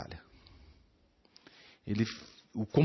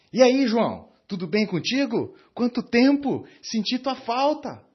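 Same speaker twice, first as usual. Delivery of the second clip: much higher and louder, bursting with joy at seeing him; enthusiastic greeting; 9 semitones higher, 7 dB louder than usual. Shown as a demonstration. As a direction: greet an old friend you truly missed. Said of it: "E agora eu vou usar das mesmas palavras de uma outra forma". (cumprimento entusiasmado, bem mais alto e forte)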